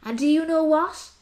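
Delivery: ordinary delivery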